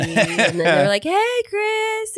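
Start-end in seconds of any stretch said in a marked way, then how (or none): 1.0-2.2 high, sing-song family greeting; cheerful relatives. Retold or imitated. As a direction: chirp a bright hello. Family greeting voiced in story.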